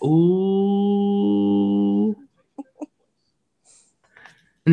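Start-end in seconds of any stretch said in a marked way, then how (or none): 0.0-2.1 mock singsong ooh. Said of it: "I'm like".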